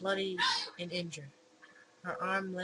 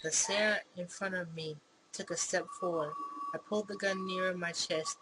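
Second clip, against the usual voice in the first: plain reading voice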